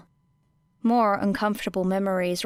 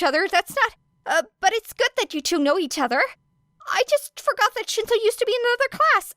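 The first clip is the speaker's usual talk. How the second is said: high, breathless nonstop babble; rambling Midoriya; 10 semitones higher than usual. Acted. Midoriya nervously rambling to Era and Shinso, high and rushed. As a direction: ramble on without a breath, pitched up. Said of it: "how you do that without passing out".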